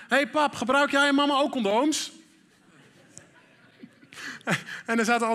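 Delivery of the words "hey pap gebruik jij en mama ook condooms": put-on higher, louder child calling back from the bike; son calling out. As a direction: shout a cheeky question over your shoulder. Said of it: "riep hij naar achteren". (zoon die vanaf de fiets naar achteren roept)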